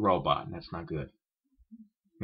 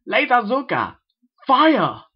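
high, loud alien battle shout; battle cry; 13 semitones higher, 11 dB louder, slower than usual. Acted. Alien hero firing at a bird, shouting high and loud. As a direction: yell the weapon command.

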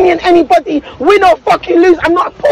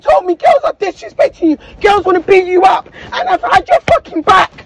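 angry shout; 2 semitones higher than usual. Real, not acted. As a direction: yell it out in real hurt anger. Heated quarrel, defending herself.

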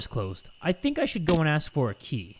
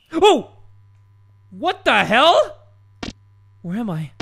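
high, loud yelp, then drawn-out disbelief; startled; 7 semitones higher, 6 dB louder, slower than usual. Real, not acted. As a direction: cry out in sudden shock. Suddenly falls into the backrooms.

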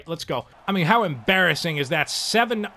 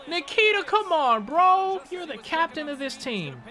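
scolding a teammate; 11 semitones higher than usual. Real, not acted. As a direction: call him out, fed up.

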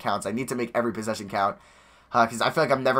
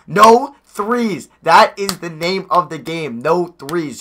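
emphatic; 5 semitones higher, 8 dB louder, much slower than usual. Real, not acted. Emphatic coaching rule.

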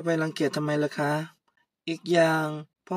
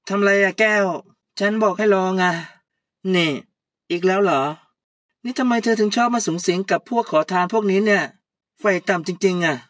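louder and higher furious scolding; angry boss; 3 semitones higher, 7 dB louder than usual. Acted. Puy yelling at Kaew about the broken accounts, louder and higher. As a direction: rant at her like an outraged boss.

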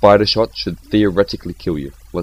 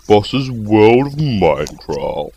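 slow, drawn-out title call; show title announcement; 3 dB louder, much slower than usual. Pretend. Opening his game video with the title, grandly announced.

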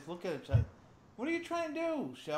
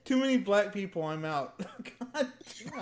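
ordinary talk

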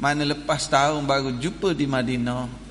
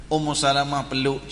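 ordinary delivery

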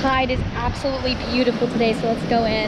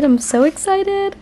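higher, slow, gushing excitement; excited; 8 semitones higher, slower than usual. Real, not acted. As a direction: squeal it out with delight. Repeats it, much more thrilled.

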